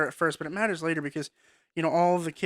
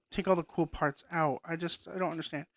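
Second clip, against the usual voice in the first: ordinary talk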